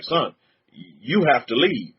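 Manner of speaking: low, lightly voiced father; father laying down the law, low and measured; a hint of a stern father; imitating stern father. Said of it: "He's like".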